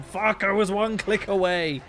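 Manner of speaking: loud, drawn-out frustrated curse; frustrated outburst. Real, not acted. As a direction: vent at the near miss. Loses in game just before netted.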